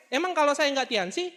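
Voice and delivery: ordinary talk, nothing marked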